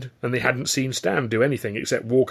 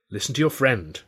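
ordinary delivery

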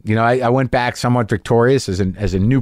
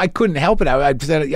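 normal talking voice

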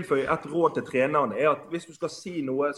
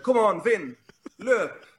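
higher imitated punter's yell; mock racetrack shouting; 5 semitones higher, slower than usual. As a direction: yell it as if urging on a racehorse. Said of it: "så skriker de". (etterapet skriking på veddeløpsbanen)

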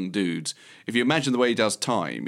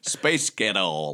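American accent; 2 semitones lower, much slower than usual. Shown as a demonstration.

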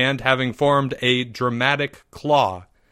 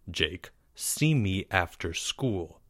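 slow, low and much softer; a cold, quiet warning; 5 semitones lower, 9 dB quieter, slower than usual. A narrator's reading. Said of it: "Mister L said coldly".

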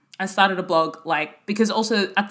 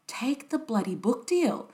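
lightly voiced insistent mum; 4 semitones higher, 8 dB quieter, slower than usual.

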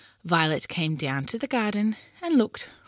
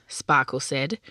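plain reading voice

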